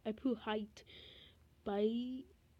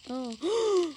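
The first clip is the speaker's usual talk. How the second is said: surprised gasp; 7 semitones higher, 6 dB louder than usual. Real, not acted. Discovering she is vampire.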